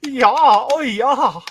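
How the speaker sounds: overacted bedroom yells, mocking; mock German moaning. Mocking German chicks to Steve, loud hammed-up moaning. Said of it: "As opposed to German chicks".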